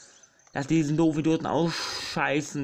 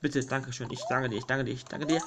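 normal talking voice

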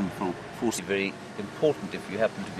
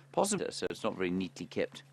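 ordinary talk, nothing marked

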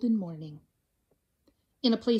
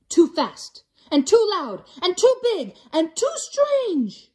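each too punched, rising higher; building stress on too; 7 semitones higher, 6 dB louder than usual. A narrator's reading. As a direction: lean harder on every too.

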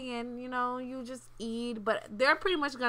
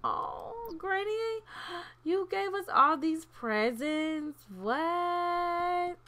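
high-pitched mock gasp of delight; acted thrilled surprise; 5 semitones higher, slower than usual. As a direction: play up being thrilled by gifts. Voicing kids thrilled by gifts.

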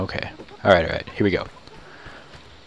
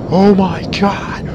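high, drawn-out shocked exclamation; startled cry; 10 semitones higher, 4 dB louder, slower than usual. Real, not acted. Player reacting to a big surprise in game, startled cry. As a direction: cry out in amazement, stretching it.

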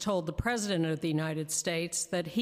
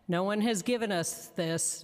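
usual speaking voice

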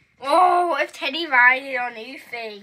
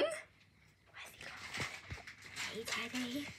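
whispered and slow, hunting for the pet; 3 semitones lower, 20 dB quieter, slower than usual. Real, not acted. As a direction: breathe the words so he isn't startled. Searching quietly for hamster.

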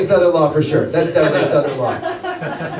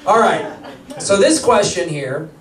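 usual speaking voice

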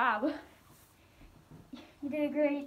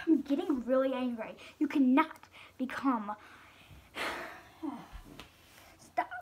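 usual speaking voice

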